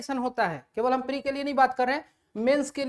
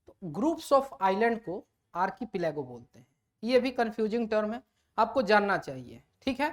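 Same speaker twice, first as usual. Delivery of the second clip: usual speaking voice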